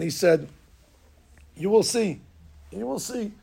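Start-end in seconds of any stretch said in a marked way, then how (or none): 1.5-2.2 firm, pointed promise; a knowing teacher's warning; insistent. Retold or imitated. Rabbi insisting. 2.7-3.3 softer knowing echo; quiet. Retold or imitated. Rabbi repeating, softer.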